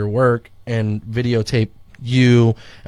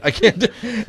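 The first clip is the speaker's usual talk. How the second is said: higher, breaking into laughter mid-plea; laughing panic; 10 semitones higher than usual. Retold or imitated. Retelling refusing the SpongeBob costume, laughing through mock panic. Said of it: "I freaking freaked out".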